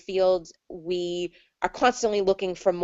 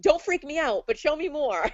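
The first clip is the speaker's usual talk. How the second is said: high-pitched, eager mock plea; giddy begging, pitched up; excited; 8 semitones higher than usual. Healer bargaining playfully with the spirits, excited. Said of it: "I'm so excited".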